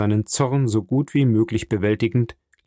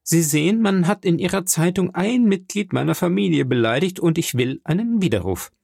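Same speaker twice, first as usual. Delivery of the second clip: higher-pitched, insistent demand; firm, brusque; 7 semitones higher than usual. Acted. Viscount whose family was insulted demands retraction, brusque. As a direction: demand the retraction firmly, almost curtly. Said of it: "sagte Albert fest, ja schroff".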